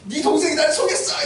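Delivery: high-pitched mock exasperation; angry outburst. Father telling elder son he was tricked, acted anger. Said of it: "그래서 화가 나가지고".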